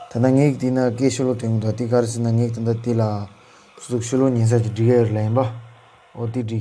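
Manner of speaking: usual speaking voice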